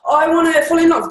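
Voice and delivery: high, loud, eager client voice. Retold or imitated. Coach quoting clients who come to her, loud high eager plea. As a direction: burst out like a client desperate for it. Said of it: "they're coming like".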